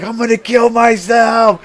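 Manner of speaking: high, loud mock wail of a panicked kid; hammed-up distraught yell; imitated crying shout. Crying brother panics in window.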